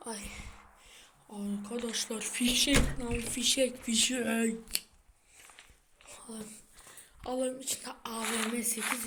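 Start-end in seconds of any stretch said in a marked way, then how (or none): none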